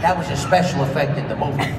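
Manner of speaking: normal talking voice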